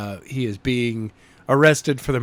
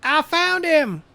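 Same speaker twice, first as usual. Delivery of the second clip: high, slow voice of a searcher on the radio; excited searcher on the radio; 14 semitones higher, slower than usual. Acted. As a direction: call it in over the radio, high and drawn out. Voice over the radio.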